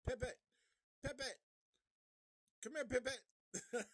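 high, loud calls for a lost dog; hammed-up owner calling his dog; frantic calling.

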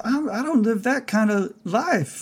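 high-pitched, reluctant protest; gentle pacifist Harry. Acted.

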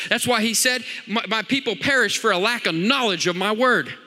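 loud, high preaching at full force; fiery preaching. Acted. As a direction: preach it hard to the congregation.

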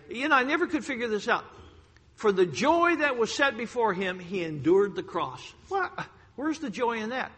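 loud, fervent pulpit delivery; preaching at full force. Acted. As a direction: drive it home to the congregation.

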